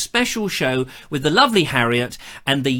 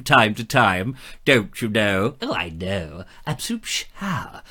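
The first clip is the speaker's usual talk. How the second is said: comic posh voice; 8 semitones lower than usual. Acted. Comic aside voice.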